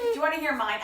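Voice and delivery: ordinary delivery, nothing marked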